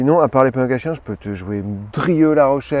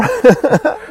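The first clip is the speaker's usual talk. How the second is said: high giggle; 8 dB louder than usual. Real, not acted. Laughs at own Mika voice.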